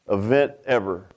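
normal talking voice

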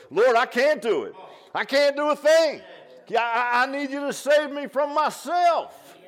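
rising to a loud, slowed cry for help; a preacher acting out a desperate sinner's prayer; pleading prayer. Acted desperate prayer, climbing.

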